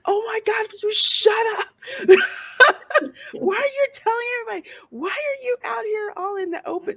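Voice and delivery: very high-pitched mock yell, half laughing; exasperated audience yelling. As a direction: imitate an exasperated viewer pleading at the screen. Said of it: "like, yelling at him".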